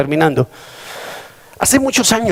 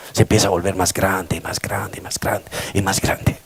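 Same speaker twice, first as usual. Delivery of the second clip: softer and softer, ending in a whisper; fading to a whisper; 6 semitones lower, 6 dB quieter than usual. Shown as a demonstration. Preacher showing fear growing, fading to whisper. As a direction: let the words shrink to a whisper. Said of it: "el miedo se empieza a magnificar".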